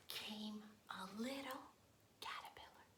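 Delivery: soft storybook whisper. Acted.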